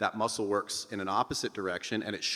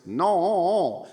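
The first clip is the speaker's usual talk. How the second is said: higher, louder nasal no; nasal resonance; 9 semitones higher, 8 dB louder than usual. Shown as a demonstration. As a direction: let the nose ring dominate. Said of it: "let a little nasal resonance dominate the voice".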